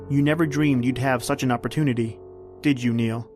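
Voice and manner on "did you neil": normal talking voice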